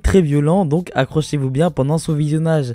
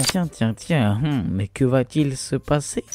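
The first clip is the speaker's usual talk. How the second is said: softer, slow sly voice of a schemer; mock mysterious; 2 semitones lower, 5 dB quieter, slower than usual. Teasing viewers about the duel, slow sly mystery. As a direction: lure them in with mock intrigue.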